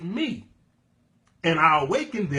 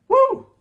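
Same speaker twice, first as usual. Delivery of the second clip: excited whoop; 8 dB louder than usual. Real, not acted. Preacher whooping.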